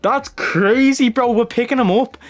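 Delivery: incredulous excitement. Real, not acted. Streamer ranting about an overpowered newborn regen, excited. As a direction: rant in delighted disbelief. Regen rated ninety-one at spawn.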